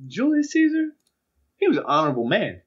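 high-pitched opening, then settling down; bluffing student orator. Retold or imitated. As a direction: imitate a kid winging a recitation. Acts Drew bluffing through the speech.

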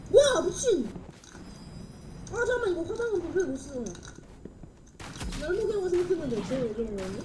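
loud fast panicked rant; panicked gamer yelling. Real, not acted. Panicked game reaction.

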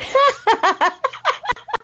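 high cackling evil laugh; villain laugh. Acted. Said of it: "it's my villain laugh".

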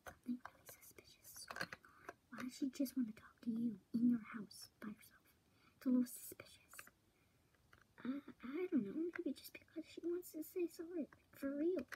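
hushed, quick conspiratorial whisper; secret whisper. Real, not acted. Friends plot in secret about the visitor, whispering. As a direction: whisper fast so she won't hear. Secret talk behind her back.

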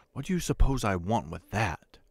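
ordinary delivery

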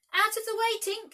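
high, loud urgent cry; cried out. A narrator's reading. Tootles cries out to Tink, loud and high. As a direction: yell it as you let fly. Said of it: "he cried".

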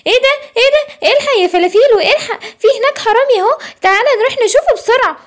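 put-on high, eager child voice calling a friend; a small monkey shouting to come quick; excited little kid. Little monkey urging Falafilo to catch the thief, high and eager.

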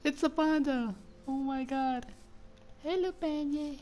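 very high, cooing excitement; high-pitched fangirl gushing; delighted squeal. Real, not acted. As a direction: squeak with joy as if greeting an adorable animal. Meets a panda, greets it.

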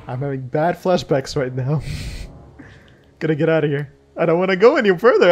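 usual speaking voice

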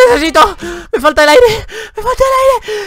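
panting, climbing to a squeal on the last plea; mock gasping for air. Player dying in the game begs for air, mock gasping. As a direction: play suffocating, voice pinched upward.

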